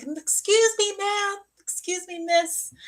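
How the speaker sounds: very high, polite little call; calling after someone. Retold or imitated. Girl chasing woman off the bus, high calling-out. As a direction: imitate a timid, high-pitched hail.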